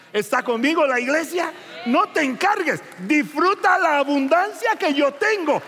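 loud, high, fervent preaching; preaching at full force. Acted. Preacher urging the congregation, fervent and loud. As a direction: proclaim it to the whole church.

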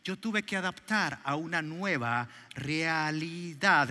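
ordinary delivery

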